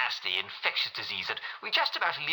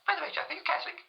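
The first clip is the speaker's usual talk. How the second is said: much higher, haughty royal lady; Queen Mary; much faster than usual. Acted. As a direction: ask it like a queen looking down. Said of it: "Her Majesty Queen Mary".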